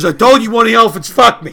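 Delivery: exasperated patient. Acted. Patient insisting to his doctor, shouted in mock exasperation. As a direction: insist on it at full volume.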